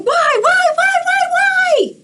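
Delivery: a cartoon bird throwing a tantrum; shrieking pigeon demand; shouting pigeon.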